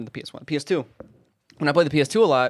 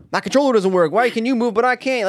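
put-on high, louder kid protest; upset little brother; 9 semitones higher, 7 dB louder than usual. Little brother realizing his controller is fake, high loud protest. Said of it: "He's like".

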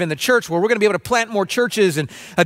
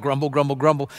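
low-pitched mock grumbling; grumbling murmur; 6 semitones lower than usual. Church member blaming leaders for reopening, in a mock grumbling murmur. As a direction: drop into an imitated monotone grumble. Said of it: "this low-toned murmur".

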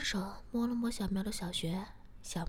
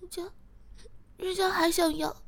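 bashful; 10 semitones higher, 6 dB louder than usual. A narrator's reading. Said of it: "低着头说".

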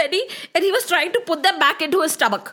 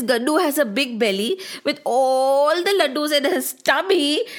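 ordinary delivery, nothing marked

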